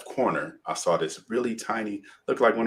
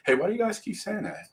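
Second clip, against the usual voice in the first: high-pitched bewildered question, retold; puzzled; 11 semitones higher, 3 dB quieter than usual. Crash victim questioning the medics, retold as puzzled.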